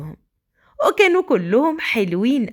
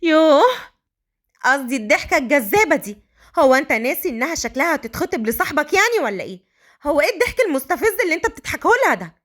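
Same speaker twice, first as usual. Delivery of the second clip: Malika, jealous; 4 semitones higher than usual. Acted. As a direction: nag him with jealous questions. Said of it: "مليكة بغيرة".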